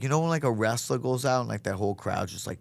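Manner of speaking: ordinary talk, nothing marked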